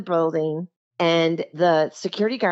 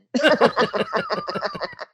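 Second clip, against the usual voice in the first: high burst of laughter. Real, not acted. Recalling sneaking into Pentagon.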